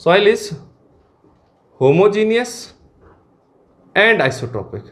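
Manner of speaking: piece-by-piece dictation, long pauses between words; dictating. Real, not acted. Teacher dictating the first soil assumption to students, paced dictation. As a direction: give the class time to write each part.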